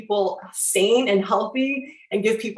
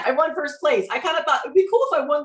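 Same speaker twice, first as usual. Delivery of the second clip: usual speaking voice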